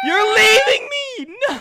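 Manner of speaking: high, loud, drawn-out wail; desperate shout. Real, not acted. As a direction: wail after the one deserting you. Left behind by teammate.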